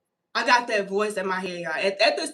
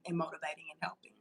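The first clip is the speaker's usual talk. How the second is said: soft spirit voice; 5 semitones lower, 15 dB quieter, faster than usual. Retold or imitated. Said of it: "it went on to say".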